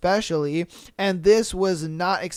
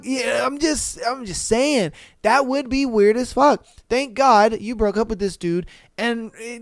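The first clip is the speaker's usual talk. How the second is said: ordinary talk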